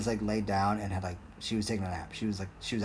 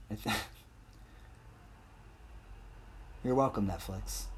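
laughing under breath; 8 dB quieter than usual. Real, not acted. Smug aside to Netflix.

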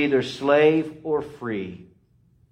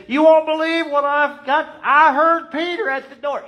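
put-on excited servant girl; 8 dB louder than usual. Rhoda bursting in excited.

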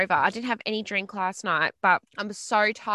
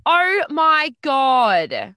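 loud, long drawn-out disbelief; shocked; 4 semitones higher, 6 dB louder, much slower than usual. Real, not acted. Cohost reacts to the listener's embarrassing tampon story, shocked. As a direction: stretch each word out higher in real shock.

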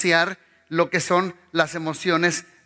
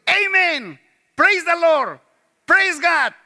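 high, louder churchy amen; gushing praise the Lord; mock ecstatic praise; 9 semitones higher, 4 dB louder, slower than usual. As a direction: ham up an excited worshipper. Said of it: "estás diciendo".

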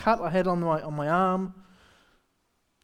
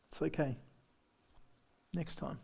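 much softer, easy-going and unbothered; calm and cool; 5 semitones lower, 17 dB quieter than usual. Retold or imitated. Patient answers the cancelled operation, soft and calm. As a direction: shrug it off calmly. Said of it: "Being cool, calm, and collective".

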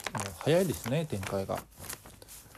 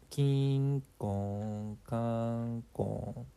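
bell imitation; much slower than usual. Narrator's school bell.

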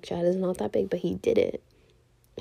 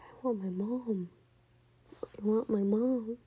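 mock whimper rising into a small wail; retold tearful plea; 3 semitones higher than usual. Recalling crying for mom at the gas station, plaintive whimper. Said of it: "I just cried and cried and cried".

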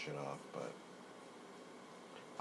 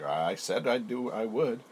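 louder, slowed, smug insistence; smug boast; 6 semitones higher, 9 dB louder, slower than usual. Retold or imitated. Imagining bragging to friends at the table, loud smug boast. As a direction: gloat about keeping your word, slowly. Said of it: "we're both gonna be like".

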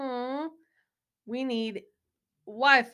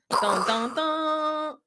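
mock suspense sting, last note higher; dramatic dun-dun-dun; 8 semitones higher, 5 dB louder, much slower than usual. Co-host celebrating a big idea, mock dramatic sting. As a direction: voice a triumphant "dun, dun, DUN".

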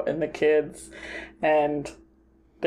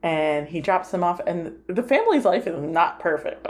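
usual speaking voice

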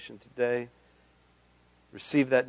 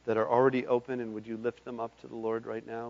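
ordinary talk

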